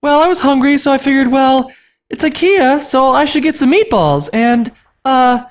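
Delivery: put-on high, sheepish girl voice; sheepish younger sister. Anna sheepishly explaining the meatballs in falsetto. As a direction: falsetto excuse.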